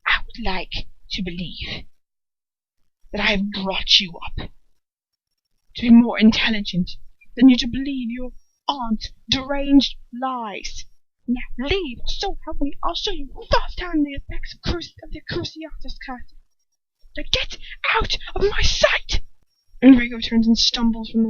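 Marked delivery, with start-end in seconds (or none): 0.1-10.9 barely controlled anger. A narrator's reading. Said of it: "just how angry he must look". 11.3-19.2 rising from threat to harsh whispered order; threaten, then spit out the order; angry threat. A narrator's reading. Lucius ordering his son out in fury. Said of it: "just how angry he must look".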